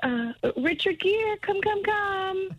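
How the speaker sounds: high, slow coaxing call; calling a pet. Real, not acted. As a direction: coax the gerbil out, drawn out. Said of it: "Can you call for the gerbil".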